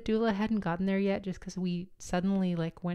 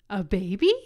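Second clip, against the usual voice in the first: loud surprised cry of a question; startled shout; 9 dB louder than usual. Retold or imitated. To everyone in the birth room, a loud startled shout. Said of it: "I just, like, shouted that".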